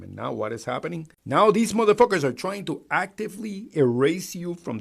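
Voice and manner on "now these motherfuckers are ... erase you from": angry. Real, not acted. Angry rant at Republicans.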